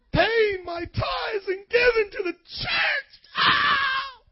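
very high, stretched fake sob; hammed-up bawl ending in a squealed cry; mock wailing complainer. Mimics griping over giving tithes.

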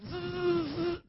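buzzing high zap; lightning zap noise. Pretend. Preacher mimics lightning from heaven, buzzing zap. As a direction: imitate a bolt zapping down. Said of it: "send down a lightning".

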